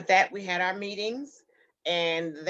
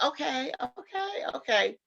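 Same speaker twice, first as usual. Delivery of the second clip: dazed surprise; 8 semitones higher than usual. Retold or imitated. Stunned reply to bill news.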